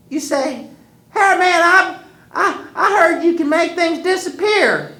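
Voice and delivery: put-on high, loud old woman giving orders; grandma instructing. Grandma telling Wiley how to trick the hairy man, high firm old woman. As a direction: lay out the plan like a firm grandma.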